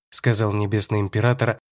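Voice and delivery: usual speaking voice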